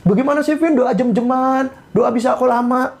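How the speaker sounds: high-pitched mock objection; incredulous questioner. Listener questioning the preacher about hours of prayer, incredulous. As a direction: pipe up high, as if baffled by the idea.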